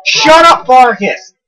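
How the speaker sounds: loud, high telling-off; angry shout. Real, not acted. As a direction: shout the companion down. Snapping at companion.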